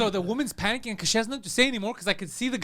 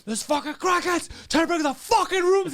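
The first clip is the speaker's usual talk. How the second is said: high, slow angry yell; acted-out outraged shout; retold yelling; 9 semitones higher, 3 dB louder, slower than usual. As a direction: yell the warning at the front desk. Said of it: "And I'm yelling at the woman".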